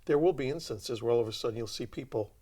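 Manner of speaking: ordinary delivery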